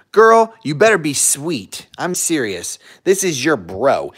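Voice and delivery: ordinary talk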